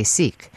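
"Sikh" with a long ee vowel, like seek; long-ee pronunciation. Shown as a demonstration. Host citing Associated Press advice, long-ee "Sikh". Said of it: "because the Associated Press".

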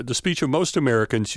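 ordinary talk

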